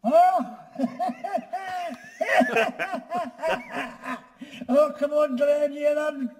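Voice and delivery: high, loud cackling puppet voice with echo; Paladin the Lamb. Shown as a demonstration. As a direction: squeal it slowly into a bin, laughing between phrases. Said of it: "this is the voice that we created".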